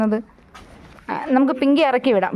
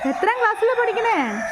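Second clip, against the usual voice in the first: asked again, pitched higher; coaxing higher repeat; 7 semitones higher than usual. Real, not acted. Teacher coaxing a shy pupil to answer, higher. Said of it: "repeats question to shy child".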